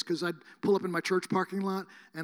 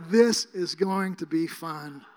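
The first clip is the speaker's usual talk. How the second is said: slow; 7 dB louder, much slower than usual. Retold or imitated. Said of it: "I'm just going".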